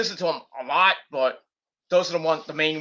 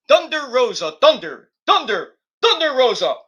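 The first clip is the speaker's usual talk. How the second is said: announcer hype; 7 semitones higher, 7 dB louder than usual. Acted. Hyping wrestler's name like announcer.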